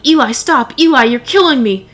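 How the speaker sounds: high, loud pleading yell; screaming in pain. A narrator's reading. Mother yelling at her son to stop, loud and high. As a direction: cry out in pain, begging him to stop. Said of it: "screaming in pain and yelling".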